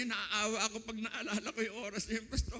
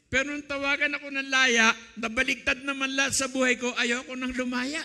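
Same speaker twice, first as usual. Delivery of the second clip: emotional outburst; 4 semitones higher, 6 dB louder than usual. Real, not acted. Overwhelmed recalling release.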